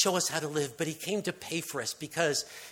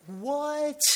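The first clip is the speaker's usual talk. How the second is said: a much higher, startled yelp; shocked cry; 12 semitones higher than usual. Acted. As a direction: squeak out your disbelief. Shocked crowd reacts.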